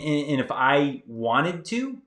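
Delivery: usual speaking voice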